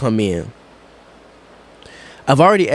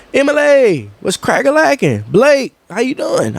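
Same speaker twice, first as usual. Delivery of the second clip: bright, high call-out greeting to coworkers; upbeat greeting; 9 semitones higher than usual. Retold or imitated. Manager greeting coworkers by name, high and lively. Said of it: "I'm saying hey to people".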